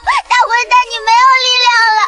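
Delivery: put-on high, tearful child's plea; distraught protest tumbling out fast; desperate pleading. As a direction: beg them not to go, rushing and high. Child begs friend not to die.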